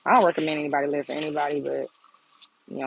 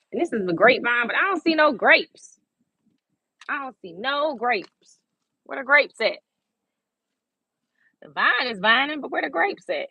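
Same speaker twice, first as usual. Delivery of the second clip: playful exasperation; 7 semitones higher than usual. Real, not acted. Puzzled at grapeless vine.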